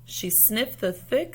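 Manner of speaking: usual speaking voice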